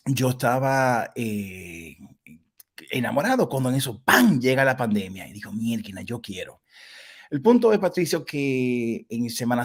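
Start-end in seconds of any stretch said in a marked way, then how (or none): none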